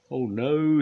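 high, loud cry of dismay; squealing. A narrator's reading. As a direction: squeal in mock alarm. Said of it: "squealed Scoop".

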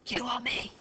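unvoiced whisper; whispered question. A narrator's reading. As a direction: ask under your breath. Lisa whispering to Fliss.

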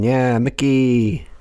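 hammed-up excited call-out; mock cheer. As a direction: cheer it slowly, stretching the end upward. Echoing the film's calls to Mickey.

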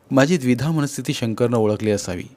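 normal talking voice

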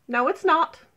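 loud, high contradiction; defiant retort. Acted. Nata defiantly contradicts the toad.

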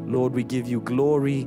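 usual speaking voice

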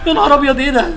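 sudden high yelp of fright; startled shout. Real, not acted. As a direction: jump out of your skin, loud and high. Startled by game creature. (صرخة مفاجأة عالية)

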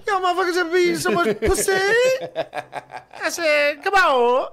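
very high, loud mock stage shout; over-the-top comic screech; hammed-up crowd yell. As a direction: holler at a club crowd in a squealing put-on voice. Hammed-up stage bit at imagined club.

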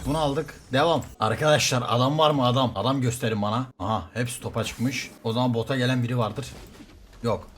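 normal talking voice

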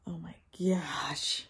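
breathless gasp then a hushed oh my gosh; awed whisper. Real, not acted. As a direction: whisper it slowly in awe. Unboxing gift books, gasping.

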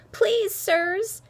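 high, urgent appeal; gasped plea. A narrator's reading. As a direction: plead loudly, as if after running. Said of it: "she gasped".

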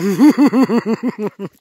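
high, loud giggle of delight; long, excited high laugh. Real, not acted. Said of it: "I'm sorry to get so excited".

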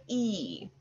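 long, soft "E"; slow demonstration of the final sound "E"; isolated sound. Shown as a demonstration. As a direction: hold "E" as a drawn-out vowel. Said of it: "saying the Y".

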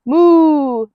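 a loud, high moo; chasing moo. Acted. The cow chases the farmer down the lane with a loud moo. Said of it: "mooing and baaing and clucking".

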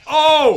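high, loud "oh!"; short burst of surprise; startled cry. Real, not acted. Surprised by sudden hit in game, startled cry.

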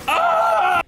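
sudden high yelp of fright; startled scream. Real, not acted. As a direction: scream as the jump scare hits. Jump scare in horror game.

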